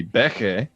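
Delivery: "Becke" said BEK-ay; stress on first syllable. Shown as a demonstration. Co-host settling how the band's name goes, "Becke" stressed on the first syllable. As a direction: land on "BEK" in "Becke", then a lighter "ay". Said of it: "there's an accent on the first syllable".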